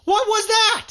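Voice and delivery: slow, then rising to a high alarmed cry; from stunned to panicked; reliving the shock, voice climbing high; retold freak-out.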